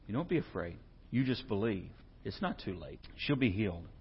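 soft, low comforting voice; calm a frightened father quietly; Jesus comforting the father softly; gentle reassurance. Acted.

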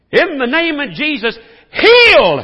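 mock the showy TV preacher commanding a healing; TV faith healer. Preacher mocking TV disciples, hammed-up faith healer shout. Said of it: "that's how the T V disciples do it".

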